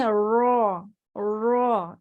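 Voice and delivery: رو said thick and rounded, not "raa"; a full heavy r sound. Shown as a demonstration. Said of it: "اسے را نہیں پڑھیں گے". (رو موٹا پڑھا جاتا ہے)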